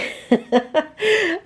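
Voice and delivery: short amused chuckle; light laugh. Real, not acted. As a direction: laugh at your own joke. Laughing at her own joke.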